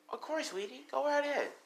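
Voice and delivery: very high, soft motherly voice; sweet mother. Acted. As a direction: lift it high and soft for your child. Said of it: "Ray's like".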